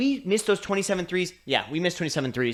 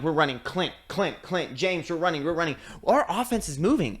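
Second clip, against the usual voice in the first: ordinary talk, nothing marked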